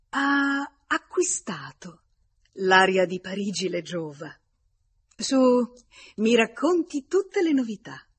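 high, loud, gushing compliments; animated hostess. A narrator's reading. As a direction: exclaim eagerly, pitch lifted well above the narration. Hostess admiring visitor, eager for news.

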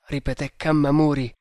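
plain reading voice

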